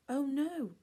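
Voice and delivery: high, loud shocked cry; cried out. A narrator's reading. Lucy crying out at the empty news desk. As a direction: gasp it out in horror. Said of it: "Lucy cried".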